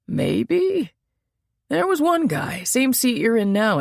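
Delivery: a high-voiced bartender trying to remember.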